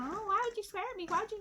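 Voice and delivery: high-pitched mocking imitation; squeaky mock outrage; caseworker going off. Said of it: "she's just going off".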